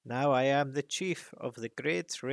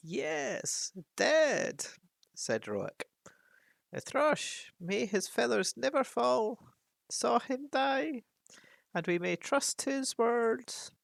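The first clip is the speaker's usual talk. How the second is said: higher, solemn raven voice; old raven; 12 semitones higher, slower than usual. Acted. Raven Roak confirms the dragon's death to dwarves. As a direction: tell the news like a wise old bird.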